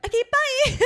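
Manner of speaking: high, loud, giggly farewell; laughing goodbye. Retold or imitated. Hanging up on her mum, still giggling. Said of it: "And eventually I was like".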